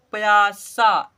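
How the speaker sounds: "प्यासा" loud and stretched on both syllables; drawn-out model reading. Shown as a demonstration. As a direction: model "प्यासा" slowly for children to copy. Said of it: "ऐसे पढ़ लेंगे". ("प्यासा" को ज़ोर से, खींचकर पढ़ें)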